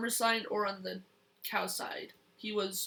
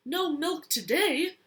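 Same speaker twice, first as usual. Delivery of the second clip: loud, slow, higher cry of disbelief; cried out; 6 semitones higher, 5 dB louder than usual. Acted. Farmer dismayed at the cows' strike, loud slow cry. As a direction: wail it like a farmer losing his milk. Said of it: "cried Farmer Brown".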